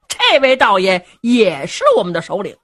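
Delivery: ordinary delivery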